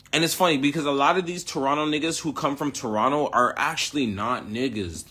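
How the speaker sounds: usual speaking voice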